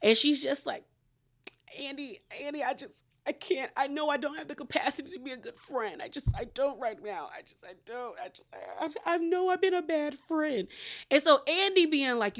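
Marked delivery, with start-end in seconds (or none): 1.8-10.7 small, high, soft mock-pitiful voice; wilted flower imitation. Host mimics castmate confessing to be a bad friend, wilted. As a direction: imitate her wilting, halting and barely audible. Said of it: "she looks like a wilted flower".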